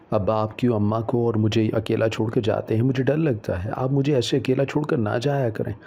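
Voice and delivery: ordinary delivery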